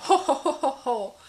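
put-on "ho-ho-ho" laughter; mock hearty, showy ho-ho laugh; exaggerated laugh. Shown as a demonstration. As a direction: laugh theatrically in round hos. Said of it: "rindo assim um pouco mais exagerado".

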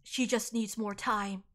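soft, slow, low reassurance; subdued father. Acted. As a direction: drop right down after the outburst. Father calms down after outburst.

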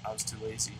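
normal talking voice

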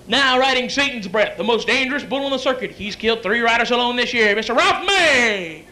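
booming arena introduction, name stretched out; rodeo announcer. Acted. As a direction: announce the rider with big build-up. Acted rodeo announcer introducing rider.

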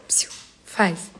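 hushed whispered prompt. Real, not acted.

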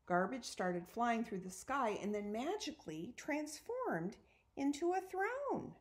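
plain reading voice

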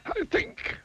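high wizard musing; a high, pleased old wizard. A narrator's reading. Wizard musing to himself about the miser.

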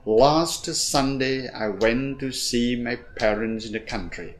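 slow, stress-timed reading; rhythmic model reading. Shown as a demonstration. Teacher models the sentence with even beats. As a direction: lean on each stressed beat slowly. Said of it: "Tức là".

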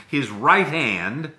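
"right" drawn out with a rising tune; slow stress on right. Shown as a demonstration. Reading scripture to the church, "right" stretched slow. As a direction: lean on "right" slowly, rising. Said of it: "everyone say".

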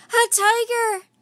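high, loud cry of alarm; alarmed shout. A narrator's reading. Jack hearing the tiger growl, high alarmed shout. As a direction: shout out the danger in fright.

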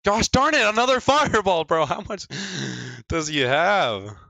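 very high, loud outburst; exasperated shout. Real, not acted. Gamer hit by another fireball, shouting in exasperation. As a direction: yell in disbelief, stretching the last words.

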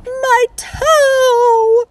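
high, loud mock sob over a hurt toe; sobbing frog. Frog crying to Sally Jane about his squashed toe. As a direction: wail it like a little frog in pain. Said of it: "sobbed the frog".

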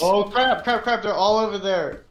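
high urgent alarm; panicked yelling. Real, not acted. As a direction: shout the warning in panic. Enemies spotted, panicked.

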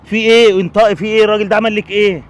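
sharp, loud demand for an answer; loud, pressing shout of concern; angry demand. Acted. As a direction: bark it at her, demanding she speak up. Stranger demands what happened.

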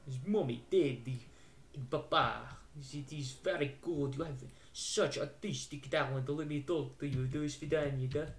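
put-on Russian accent, simple and measured. Visitor praising a girl's drawing, Russian accent. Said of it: "It's only fluently Russian".

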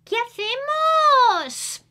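put-on high, loud, slow little girl's question; a worried little girl asking what now; child asking. Manuelita asks her grandmother, high and loud. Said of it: "Y Manuelita pregunta".

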